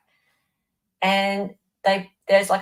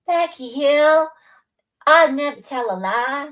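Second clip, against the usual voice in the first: mock prim viewer; 7 semitones higher, 5 dB louder than usual. Voicing viewer Becky Hill's innocence in a higher put-on voice. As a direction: play the goody-goody swearing she's honest.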